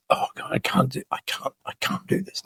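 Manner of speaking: hushed, breathless dread; whispered panic. Retold or imitated. Alone before a big talk, dreading the slides: whispered panic.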